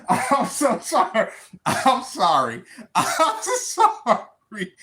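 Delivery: sorry through real laughter; giggling it off, half-embarrassed; laughing apology. Real, not acted. Laughing apology after the outburst.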